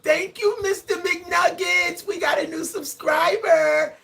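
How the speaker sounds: high, slow, thrilled thanks. Real, not acted. High thrilled thanks to a new subscriber.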